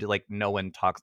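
ordinary talk, nothing marked

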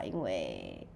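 ordinary talk

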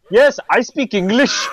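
light, laughing Connery Scottish lilt; a hint of Connery's Scottish accent; Sean Connery accent. Co-host quoting Connery's line in his accent. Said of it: "unintentional accent since Sean Connery said".

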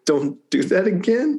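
high, slow mock scolding; half-hearted telling-off through a smile; smiling reprimand. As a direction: scold a child while barely hiding a smile. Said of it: "kind of smile, reprimand her".

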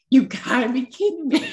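a touch of choked-up disbelief, voice lifting; half-laughing, half-tearful disbelief; overwhelmed disbelief. Retold or imitated. Opening her own gift box, overwhelmed and disbelieving. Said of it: "And I was like".

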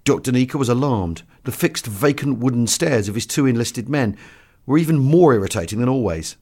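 ordinary delivery, nothing marked

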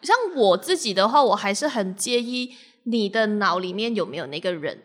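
ordinary delivery

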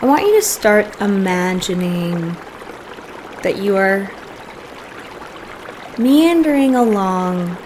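soft, unhurried meditation voice with long pauses; guided meditation. Real, not acted. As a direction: gently guide listeners into settling and breathing.

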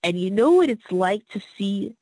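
ordinary talk, nothing marked

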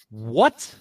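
a loud, baffled "What?" twice; stunned disbelief. Real, not acted. Co-host hears the team names, disbelieving What. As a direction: react like you can't believe the name.

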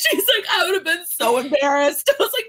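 laugh-cry through the line; real laughter mixed with tears; laughing and tearful. Real, not acted. Retelling mom ugly crying, laughing.

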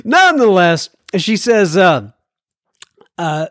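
ordinary talk

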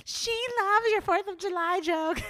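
high, slow giddy imitation; tipsy friend. Imitating her tipsy friend gushing to Jeff Ross. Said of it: "she's like".

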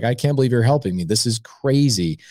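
normal talking voice

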